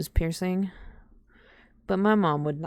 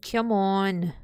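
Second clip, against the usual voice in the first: ordinary talk, nothing marked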